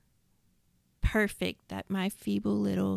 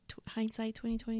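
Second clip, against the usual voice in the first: hindsight twenty twenty, quick and much softer, testing the order; reworded phrase; 7 dB quieter, faster than usual. Shown as a demonstration. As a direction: mutter "hindsight twenty twenty" rapidly as a check. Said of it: "so is that said right?".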